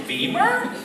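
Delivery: very high, questioning squeak; tentative guess. Retold or imitated. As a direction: offer an answer as a timid question. Unsure answer to the experts.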